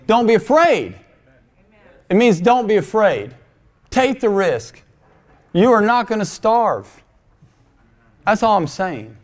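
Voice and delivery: high-pitched, insistent preaching; emphatic exhortation. Acted. Preacher telling congregation to take the risk, emphatic. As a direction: urge them firmly to be brave.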